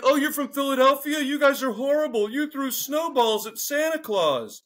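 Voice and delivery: the locals mocking a Philadelphian, high and sneering. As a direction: scoff at the Philly guy. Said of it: "Everyone's like".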